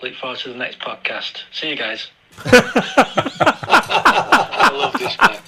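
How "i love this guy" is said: high, slow breathy laugh through the words. Real, not acted. Caller amused by the angry letter, laughing. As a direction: wheeze it out while laughing.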